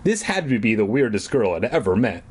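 usual speaking voice

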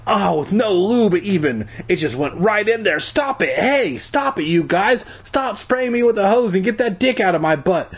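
high-pitched indignant protest; crying out. A narrator's reading. Boy yelling at kids spraying him, crying out. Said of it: "I cried".

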